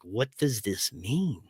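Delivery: slow, soft, dazed murmur to himself; asking himself, lost and quiet. Retold or imitated. Waking up lost, asking himself in a soft murmur. Lost, confused morning after.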